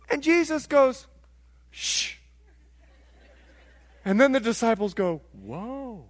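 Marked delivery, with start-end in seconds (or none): 1.7-2.1 acted-out hush to calm a storm; hushing shh. Jesus hushes the storm. 5.3-6.0 soft, drawn-out whoa of amazement; hushed wonder, as if stunned; acted-out astonishment; awed whoa. Disciples awed by calmed storm.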